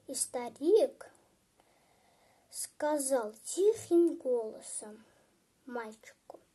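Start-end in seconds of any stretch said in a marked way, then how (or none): none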